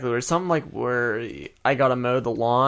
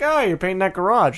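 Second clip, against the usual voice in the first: sarcastic quip; 6 semitones higher than usual. Retold or imitated. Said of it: "I'm gonna be like".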